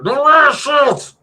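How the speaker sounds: high, loud, slow put-on scream; screamed rule. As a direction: shriek the ban like a cartoon villain. Repeating banned item rule.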